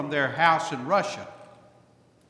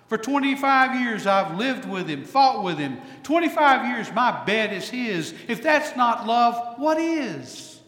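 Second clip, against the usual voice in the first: a touch higher, weighing the years aloud; Golda reflecting; 6 semitones higher, 4 dB louder than usual. Retold or imitated. Preacher lightly voicing Golda answering her husband. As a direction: build to a rueful question. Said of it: "Golda sighs".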